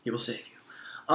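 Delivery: breathy whispered blessing, slow; mock preacher whisper. Vlogger whispers a fake blessing to viewers, slow and hushed. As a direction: hush it like a joking televangelist.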